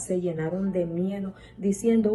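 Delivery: usual speaking voice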